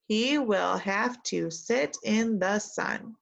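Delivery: slow, careful sentence to repeat; slow model reading. Shown as a demonstration. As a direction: read it slowly for the class. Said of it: "Repeat after me".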